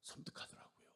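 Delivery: hushed, eerie; whispered chill. Real, not acted. Preacher telling the congregation, eerie whisper. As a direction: breathe it out softly. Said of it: "chilling remark".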